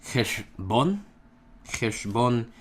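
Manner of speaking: cheshbon with a throaty ch at the start; Hebrew word demo. Shown as a demonstration. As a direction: say cheshbon twice, scraping the ch.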